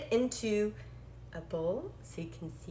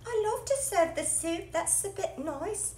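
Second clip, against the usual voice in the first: much higher, cute puppet voice, slowing at the end; Nessie puppet voice; 9 semitones higher than usual. Pretend. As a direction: squeak like a happy little toy. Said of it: "Nessie says".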